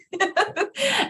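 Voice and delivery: high giggle after the joke; high laugh. Real, not acted. Host laughing at her own mock panic, high giggle. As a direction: let a squeaky laugh out.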